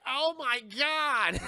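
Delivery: high, loud, slow exclamation; mock horrified cry. Onlookers seeing a blob roll down the street, mock horrified cry. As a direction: stretch it out in hammed-up panic.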